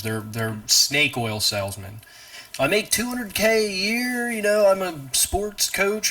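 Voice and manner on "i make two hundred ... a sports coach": slow, high mocking boast; put-on sing-out voice, dragging the brag; bragging bullshitter. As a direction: imitate a self-important show-off.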